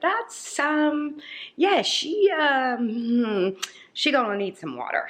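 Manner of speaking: high, drawn-out hesitant reaction; mock concern. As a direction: voice your doubtful thought up high, with comic hums. Acted reaction to a thirsty plant.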